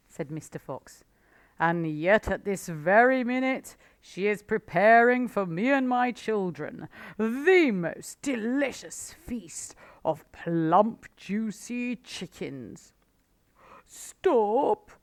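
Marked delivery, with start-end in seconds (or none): 1.6-12.9 loud, slow, savouring the food words; the fox tempting Badger with chickens; sly, gloating Mister Fox. A narrator's reading. Fox teases with feast. 13.9-14.9 high, loud pained cry; cried out. A narrator's reading. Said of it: "cried Badger".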